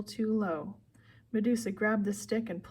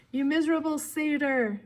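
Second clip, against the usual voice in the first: high, loud villainous snarl; enraged monster woman; angry snarl; 6 semitones higher, 5 dB louder than usual. A narrator's reading. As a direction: snarl it in rage at an attacker. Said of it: "she snarled".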